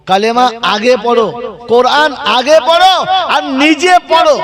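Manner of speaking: fervent preacher's rising call; rising exhortation. Acted. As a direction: hammer the crowd with each command.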